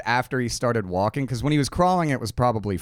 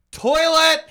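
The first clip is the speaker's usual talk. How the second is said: high, loud mock yell; imitated warning yell; 5 dB louder than usual. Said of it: "he would have to yell".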